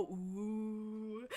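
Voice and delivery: soft crowd going ooh; a hushed, impressed crowd ooh. Acted. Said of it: "everybody behind her go".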